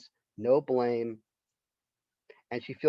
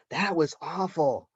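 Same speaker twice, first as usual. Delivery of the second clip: put-on slow, higher, small kid's voice; little boy whispering; 5 semitones higher, slower than usual. Little boy telling his mom about the recital, small mock child voice. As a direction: play a blunt little boy, slowed down. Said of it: "little boy whispers to his mom".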